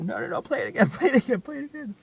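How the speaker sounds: loud, laughing plea; excited begging. Retold or imitated. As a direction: beg for it again through laughter. Begging nurse to replay song.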